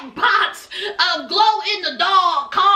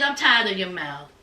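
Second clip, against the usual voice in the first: low, flat-out fed up; weary drunk boyfriend; 11 semitones lower, 4 dB quieter than usual. Retold or imitated. Drunk Jovi snapping back at Yara, low grumble. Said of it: "And so Jovi said".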